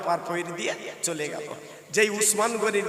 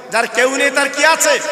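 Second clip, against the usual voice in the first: raised, forceful question to the crowd; louder call; 5 semitones higher, 9 dB louder than usual. Shown as a demonstration. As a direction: say it loud to rouse the listeners. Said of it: "জোরে বলতে হবে".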